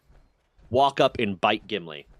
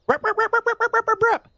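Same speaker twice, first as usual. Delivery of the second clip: much higher, yappy little bark sounds; imitated small dog yapping; 4 dB louder than usual. Mimicking the dachshund yapping at Gimli, high yaps. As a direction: yap like a tiny angry dachshund.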